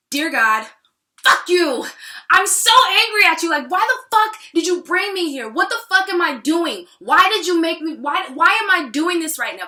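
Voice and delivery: loud, high, angry outburst; furious email to God. Retold or imitated. Typing an email to God about being brought here, furious outburst. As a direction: shout it at God, demanding answers. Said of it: "tell him exactly how I feel".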